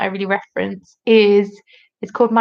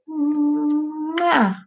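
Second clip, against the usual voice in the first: blown kiss; 6 semitones higher than usual. Real, not acted. Blowing a kiss to a viewer.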